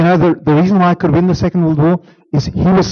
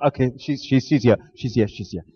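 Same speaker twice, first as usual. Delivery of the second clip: quiet reassurance fading softer; calming down; 6 semitones lower, 6 dB quieter, faster than usual. Retold or imitated. An infant soothing itself once mom returns, quiet. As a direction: settle yourself down, softer and softer. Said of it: "we quiet down".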